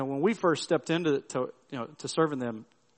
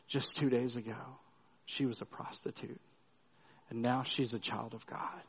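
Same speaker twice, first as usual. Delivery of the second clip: soft, hushed confidence; hushed words to the pastor beside her; quiet confiding; 3 semitones lower, 9 dB quieter than usual. Retold or imitated. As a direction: lean in and tell him softly. She leans in and tells him.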